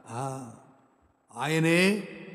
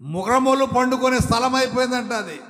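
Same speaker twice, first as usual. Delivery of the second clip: higher and louder, hammering మృగములు; full-force preaching; 9 semitones higher, 7 dB louder than usual. Acted. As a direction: preach it out to the hall. Preaching climax. (ఉద్రేకంతో బోధన)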